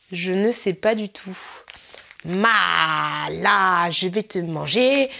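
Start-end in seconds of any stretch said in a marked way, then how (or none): 2.2-5.1 put-on low, slow Congolese-Italian mash-up accent. Vlogger hamming over an Italian food label, mixed Congolese-Italian accent. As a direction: put on a drawn-out, deep mix of Congolese and Italian. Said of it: "Je t'ai fait un accent congolais avec un accent italien".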